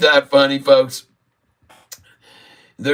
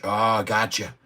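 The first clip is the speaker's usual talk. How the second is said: usual speaking voice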